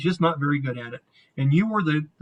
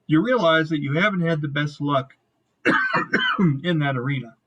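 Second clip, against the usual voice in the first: ordinary talk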